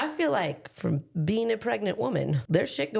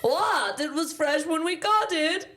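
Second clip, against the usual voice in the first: whiny cartoon pea; 8 semitones higher, slower than usual. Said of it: "And they're like".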